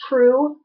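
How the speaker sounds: "true" for "through", TH as T; "true" with a hard T, no TH; Chicago accent. Shown as a demonstration. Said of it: "instead of through".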